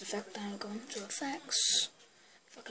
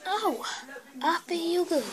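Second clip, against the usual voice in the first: excited; 7 semitones higher than usual. Pretend. Playful song-like exclamation.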